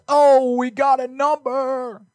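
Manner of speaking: high, slow excited announcement. Acted. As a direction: draw it out high and thrilled. Excited about a caller.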